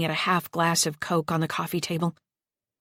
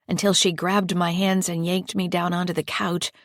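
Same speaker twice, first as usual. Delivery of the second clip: ordinary delivery, nothing marked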